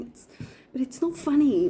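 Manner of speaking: mock-seething, half crying it out; retold seething. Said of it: "sitting there just seething".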